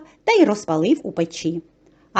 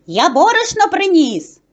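high, proud rooster call; 6 semitones higher, 4 dB louder than usual. A narrator's reading. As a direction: call the mice with the news. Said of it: "кличе мишенят".

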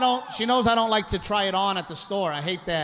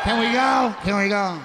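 put-on whining like a cranky eight-year-old, louder; whiny kid; 3 semitones higher, 4 dB louder than usual. Husband in the dressing room, whining like a mad kid. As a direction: pester to leave like a bored child. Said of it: "like a mad eight-year-old".

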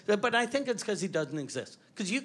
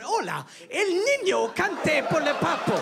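higher-pitched mock Spanish stage delivery; mock Spanish performer, hammed-up and high; Spanish-language stage show; 7 semitones higher, 4 dB louder than usual. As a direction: belt it out as if playing Mexico City. Imagined touring act in Mexico City.

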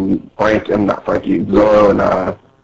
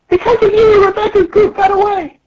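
squeaky Pika voice. Acted. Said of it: "Pika's talking".

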